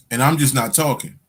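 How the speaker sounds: ordinary talk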